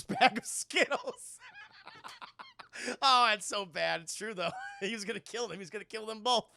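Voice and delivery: usual speaking voice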